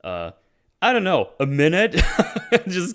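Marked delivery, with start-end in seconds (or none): 2.1-2.6 a quick, high, breathless laugh; breathy laugh. Real, not acted. Amused by the minute of hacking, breathy laugh. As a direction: snort out a laugh at the absurdity.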